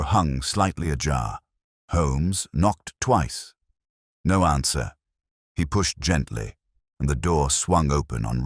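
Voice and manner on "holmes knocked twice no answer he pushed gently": ordinary delivery, nothing marked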